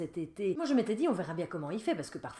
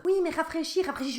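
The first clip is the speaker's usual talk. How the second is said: put-on high, louder insistent teen; nagging son; 11 semitones higher, 7 dB louder than usual. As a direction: keep pestering like a know-it-all kid.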